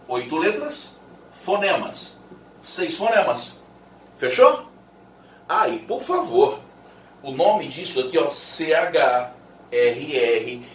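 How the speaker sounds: ordinary talk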